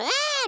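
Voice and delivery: high, loud cartoon shriek; piercingly high yell of alarm; freaked-out scream. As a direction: scream in mock panic. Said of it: "he starts freaking out".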